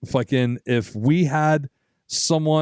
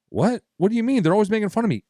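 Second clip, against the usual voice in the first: higher, baffled protest; he can't believe they like him; lightly voiced hurt friend, pitched up; disbelieving buddy; 7 semitones higher, faster than usual. Buddy protests he's mocked.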